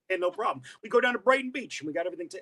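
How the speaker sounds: quick Brooklyn patter; fast, thick Brooklyn accent. Shown as a demonstration. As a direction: rattle it off like a Brooklyn local. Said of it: "that's exactly how I would sound".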